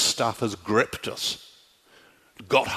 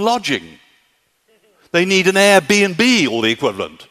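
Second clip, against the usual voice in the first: higher, louder, incredulous apostle; Paul scolding the Athenians; 7 semitones higher, 6 dB louder than usual. Retold or imitated. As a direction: chide them as Paul would, voice climbing. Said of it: "he says".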